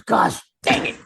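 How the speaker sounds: high, strained mock outburst; imitated exasperated outburst. Boss walking out of the screening room, imitated high outburst.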